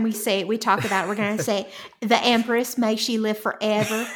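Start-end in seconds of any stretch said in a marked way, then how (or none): 2.0-4.1 put-on Texan twang; Texan drawl. Shown as a demonstration. Host decrees how to say the title, Texan drawl. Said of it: "we're gonna have to say that from now on".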